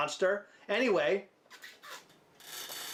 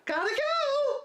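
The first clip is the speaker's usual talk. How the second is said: put-on squeaky girly voice; slower than usual. Girlfriend ditching her partner, in a squeaky high voice. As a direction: chirp it out like a flighty girlfriend bolting. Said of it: "And you say".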